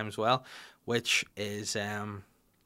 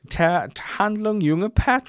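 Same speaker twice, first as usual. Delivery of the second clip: loud, high, slow German; mock German accent; 9 semitones higher, 7 dB louder, much slower than usual. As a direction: belt out the translation in hammed-up German. Repeating German translation.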